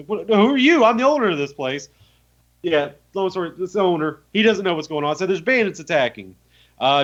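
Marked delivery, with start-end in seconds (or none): 0.1-1.9 raised indignant voice. Retold or imitated. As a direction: demand to know who's there. Owner indignant at intruder.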